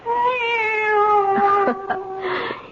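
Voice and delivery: high, delighted little laugh; gleeful girlish laugh. Acted. Girl laughing with delight over her cat.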